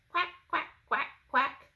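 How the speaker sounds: high, loud duck quacking; Mama Duck voice. Acted. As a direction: call out like a mother duck. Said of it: "Mama duck said".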